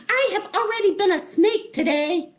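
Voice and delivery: very high, loud yell; yelling Wanda. Acted. Wanda yells at the Wizard, very high. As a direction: shriek it in protest. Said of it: "yelled Wanda".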